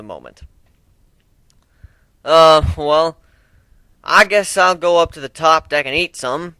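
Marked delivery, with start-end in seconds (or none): 2.2-6.6 higher and loud, awkward; Appledash voiced. Appledash ushers the group out, loud and awkward. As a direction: cover the awkward moment loudly. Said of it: "Appledash stated".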